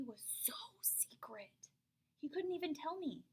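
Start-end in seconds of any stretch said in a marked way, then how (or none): 0.0-1.7 whispered, hushed aside; hushed narration; secretive whisper. A narrator's reading. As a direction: tell it like a secret. Secret recipe in story.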